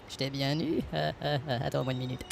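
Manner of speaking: deep, warped voice like a frog; voice changer. Acted. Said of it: "ta voix sonne comme une grenouille".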